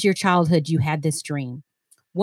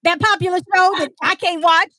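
surprised friends; 9 semitones higher, 3 dB louder than usual. Retold or imitated. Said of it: "they're like".

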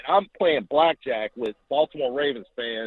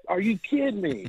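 a disbelieving outburst in a put-on, higher voice; mock disbelief; 6 semitones higher than usual. Told his brother saw Franco without him, mock outrage. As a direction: ham up the shock. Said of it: "I'm ready to beat up Brett going".

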